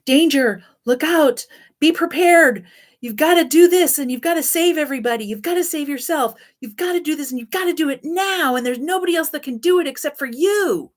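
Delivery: high, loud, frantic alarm; panicked trauma voice. Acted. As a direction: shout warnings like a scared little child. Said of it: "a scared little child that is always sort of shouting".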